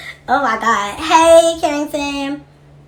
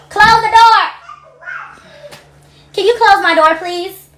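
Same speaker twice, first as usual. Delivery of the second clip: high, loud call across the house; 10 semitones higher, 8 dB louder, slower than usual. Real, not acted. Host calling offscreen to her family, loud call. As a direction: call out to someone in another room.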